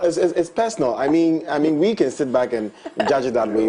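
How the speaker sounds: chuckling through the words; amused, laughing delivery. Real, not acted. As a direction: laugh as you insist it's personal. Laughing while making point.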